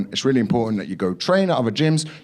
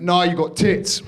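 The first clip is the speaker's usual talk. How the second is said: higher, slow, deadpan verdict; lightly voiced bluntness; gym guy; 9 semitones higher, slower than usual. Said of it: "He went".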